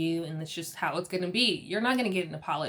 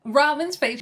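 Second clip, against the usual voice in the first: usual speaking voice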